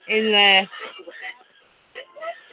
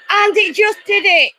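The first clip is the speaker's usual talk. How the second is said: high, louder burst of amazement; excited; 7 semitones higher, 4 dB louder than usual. Real, not acted. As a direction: blurt it out, thrilled it worked. Spirit box answered the joke.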